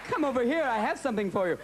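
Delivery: high, lightly voiced lady calling over; a hint of an eager lady beckoning; lady's voice. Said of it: "This lady going".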